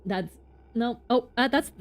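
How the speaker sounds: ordinary delivery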